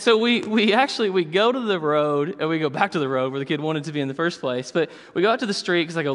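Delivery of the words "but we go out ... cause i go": normal talking voice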